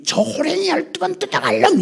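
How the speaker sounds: high, strained mock scolding; mother's angry curse. Mother cursing her son when really angry, mock shrill scold. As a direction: screech it like an exasperated mother. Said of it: "이래".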